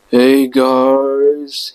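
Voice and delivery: put-on slurred, drunken greeting; drunk slurring. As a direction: slur it like you're drunk. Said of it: "slurring his words badly".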